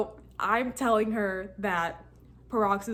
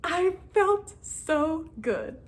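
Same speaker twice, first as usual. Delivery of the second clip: much higher and very slow, savoring it; drawn-out delight; 9 semitones higher, 4 dB louder, much slower than usual. Real, not acted. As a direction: stretch every word out in joy. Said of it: "I was so excited".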